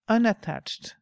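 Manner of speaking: ordinary talk, nothing marked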